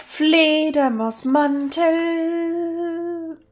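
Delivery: high, very slow sing-song stretch of "Fledermausmantel"; drawn-out item name. Acted. Gamer announcing a looted item, sing-song drawl. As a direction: savour the word as a prize.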